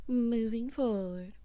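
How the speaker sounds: drawn-out moving forward; slow drawn segue. Real, not acted. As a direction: announce the transition slowly.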